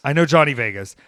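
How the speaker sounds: know pressed hard and loud; insistent repeat. Real, not acted.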